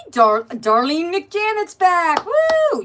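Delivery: loud, high, slow announcement ending in a whoop; excited cheer. Real, not acted. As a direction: hail her return like a game-show host. Cheering a returning viewer.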